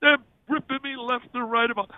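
ordinary talk

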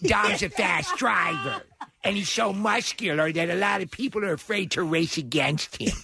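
hammed-up stage diva praising a tough driver. Co-host as the star admiring [name]'s driving, diva impression. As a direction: imitate an over-the-top Broadway entertainer. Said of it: "[name] in the Fast and Furious".

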